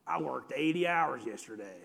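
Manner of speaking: low, soft one-upper voice; put-on low voice of a competitive boaster; one-upping guy. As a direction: imitate a man topping everyone's story. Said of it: "here comes that guy".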